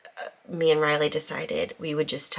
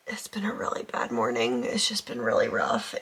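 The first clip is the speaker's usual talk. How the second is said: hushed, teary whisper; barely holding it together after tears; after crying; 7 semitones lower than usual. Real, not acted. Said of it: "I have been crying".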